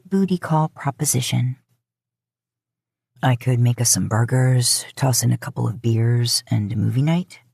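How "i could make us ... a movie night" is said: lower, easygoing male voice, casually inviting; lower male love interest. Acted. Man on the phone inviting her over, lower, easy voice. Dean pitches burgers and movie.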